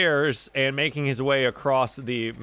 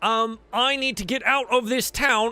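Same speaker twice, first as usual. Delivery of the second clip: higher-pitched sailor arguing to get away; ship hand, exasperated; 7 semitones higher than usual. Acted. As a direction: complain like a fed-up crewman.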